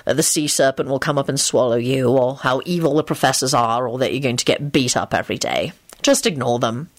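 low, measured warning; low-voiced reassuring advice; Lupin. A narrator's reading. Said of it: "Lupin had warned him".

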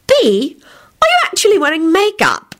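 very high, shocked question. A narrator's reading. Rosie notices Bea's makeup, high and shocked. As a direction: gasp the question out in disbelief. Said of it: "said in a shocked tone".

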